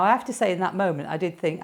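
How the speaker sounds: ordinary talk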